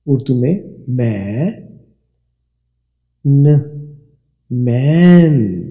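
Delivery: मैं and न said slowly, then blended into मैन; model pronunciation of man. Shown as a demonstration. Teacher slowly sounding out मैन for pupils. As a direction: stretch मैन long and full. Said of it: "अब इसकी आवाज़ देखिए".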